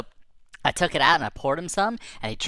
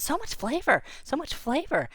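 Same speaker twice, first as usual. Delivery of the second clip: high-pitched delight; acted wonder, much higher; delighted relish; 13 semitones higher than usual. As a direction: marvel at the taste in a high voice. Creature thrilled by taste.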